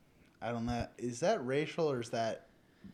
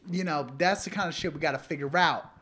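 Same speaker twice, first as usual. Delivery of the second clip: normal talking voice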